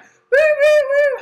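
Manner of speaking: high, loud whooping cheer; party cheering. Retold or imitated.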